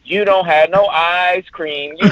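imitating Eddie Murphy's ice cream routine; Eddie Murphy bit. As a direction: drag it out like a bratty child. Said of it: "I'm gonna do the Eddie Murphy".

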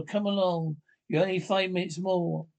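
usual speaking voice

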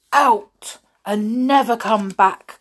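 loud, forceful banishing order; angry cry. A narrator's reading. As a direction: cry out, punching "Out" and "never". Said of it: "cried Brillo".

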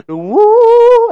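very high, loud woo; howling woo. Retold or imitated. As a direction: howl it at the moon. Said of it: "and it was like".

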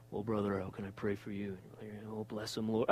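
low, soft churchy tone; pious prayer voice. Retold or imitated. Pastor parodying routine ministry, low and softly pious. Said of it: "your standard way of ministering".

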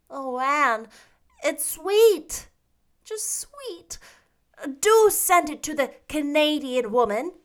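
put-on high, gushing girlish praise; enraptured Diana. A narrator's reading. Diana gushing over Anne's poem, enraptured and high.

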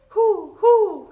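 loud, very high hoots; two high, rounded owl calls; owl hooting. Acted. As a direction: hoot like a storybook owl. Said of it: "asked the owl".

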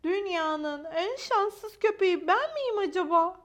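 high, slow, loud lament; self-pitying wondering. Acted. Maya wonders if she is unluckiest, high self-pitying lament. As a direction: draw out a woeful question.

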